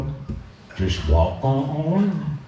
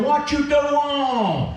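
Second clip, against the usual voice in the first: much higher, slow barking challenge; trooper's shout; 11 semitones higher, much slower than usual. Acted. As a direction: yell it like the trooper catching him. State trooper confronts the dumper.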